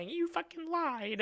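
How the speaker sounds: very high, drawn-out imitation; mock accusation. Girlfriend catching him out, high mock accusation.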